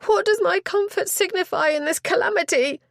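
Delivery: high, loud distraught lady; anguished cry. Acted. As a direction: cry out that comfort does not matter. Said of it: "She uttered a cry".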